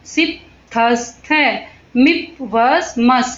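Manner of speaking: drawn-out suffix chant, syllable by syllable; slow rote listing of endings; slow recitation. Shown as a demonstration. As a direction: recite each ending slowly for the class to copy. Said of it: "इस तरह से हमने क्या लगाए".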